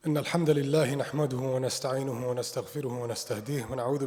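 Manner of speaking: fast formulaic khutbah opening; rapid Arabic recitation. Acted. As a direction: rattle off the opening praise.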